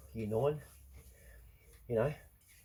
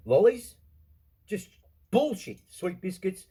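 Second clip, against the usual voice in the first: loud, slow, outraged listing; indignant rant; 8 semitones higher, 8 dB louder, slower than usual. Real, not acted. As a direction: spit out each item in disgust. Ranting about shoplifters of treats.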